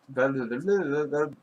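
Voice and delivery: stuck repeating a word; real stumble, words won't come out; stuttering. Real, not acted. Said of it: "I couldn't get the words out".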